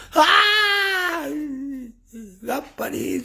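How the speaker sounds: high crying shout of fright; hammed-up scared yelp; frightened shriek. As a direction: shriek as the ghost comes. Disciple screams in fright.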